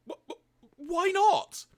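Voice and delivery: stammer, then a high loud yelp; emotional stammer. Acted. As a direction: splutter into a hammed-up squealed protest. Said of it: "in your emotional state".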